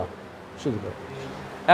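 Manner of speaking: উৎসাহিত ফিসফিস করে ধীরে; soft emphasis. Real, not acted. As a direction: breathe the key word slowly. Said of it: "সবসময় লেখাপড়া হলো উৎসাহিত করা".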